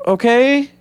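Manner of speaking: high, drawn-out bemused okay; the speaker after the abrupt ending, high bemused drawl; baffled okay. Retold or imitated. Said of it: "I'm like".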